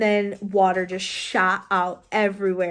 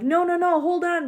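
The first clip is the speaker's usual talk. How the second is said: retold alarm; 8 semitones higher than usual. Retold staff stopping her in alarm.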